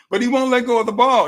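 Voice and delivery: ordinary delivery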